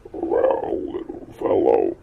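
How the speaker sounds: deep, loud croaky frog; old frog greeting the unicorn, deep and froggy; deep frogish voice. Acted. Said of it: "in a deep frogish voice".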